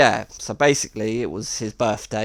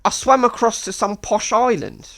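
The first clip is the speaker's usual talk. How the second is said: higher boastful storyteller voice; lightly voiced, higher tall-tale teller; imitating Miles; 8 semitones higher, 4 dB louder than usual. Miles telling his tall tale, in a higher mock voice. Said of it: "But he was like".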